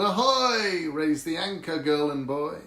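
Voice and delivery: loud, high pirate call. Acted. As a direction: hail them across the deck. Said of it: "All the pirates called".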